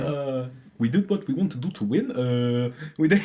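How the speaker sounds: lightly imitating a footballer's odd accent; imitating Sancho's accent. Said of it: "with a German accent".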